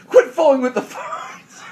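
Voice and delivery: high, slow scolding voice; mother scolding son on phone; mom scolding. Retold or imitated. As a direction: nag him like an exasperated mom. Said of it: "First she goes".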